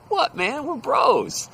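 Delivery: high, loud, surprised protest; pleading protest. Retold or imitated. Coworker protesting to the ranting quitter that they are friends, high pleading voice. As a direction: push it up high as if wrongly accused. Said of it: "and I go".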